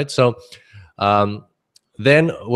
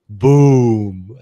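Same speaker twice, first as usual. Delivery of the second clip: punchy louder boom; excited boom; 6 dB louder than usual. Acted. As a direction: throw out a loud playful boom. Mock market crash exclamation.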